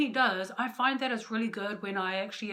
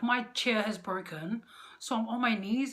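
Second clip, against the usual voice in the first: ordinary delivery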